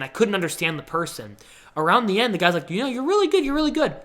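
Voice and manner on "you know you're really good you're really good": high, upbeat interviewer impression; imitated praise. Phone interviewer praising the candidate, high imitated praise. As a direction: pitch up, praising the candidate brightly.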